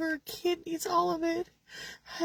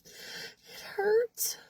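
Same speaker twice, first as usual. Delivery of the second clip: voice breaking; 8 semitones higher than usual. Real, not acted. Breaking voice repeating it hurts.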